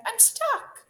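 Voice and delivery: high, loud wail of alarm; distressed cry. A narrator's reading. Rabbit stuck in a ditch, crying out. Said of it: "she cried".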